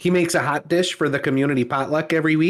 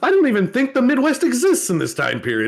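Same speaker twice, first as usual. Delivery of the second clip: higher, exasperated griping; baffled townsperson ranting about the mayor, pitched up; mock baffled complaint; 8 semitones higher than usual. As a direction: rant like a confused local. Acting a confused local complaining.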